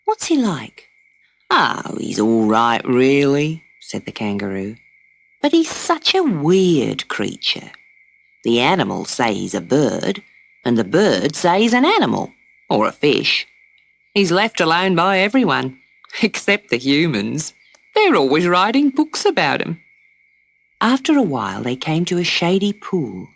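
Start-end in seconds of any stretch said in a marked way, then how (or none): 0.0-0.9 put-on high child's question; curious little girl. Dot asks the kangaroo, high and curious. Dot's voice. 1.5-19.8 easy, knowing storyteller tone; lightly voiced kangaroo. The kangaroo explains the platypus to Dot, lightly voiced.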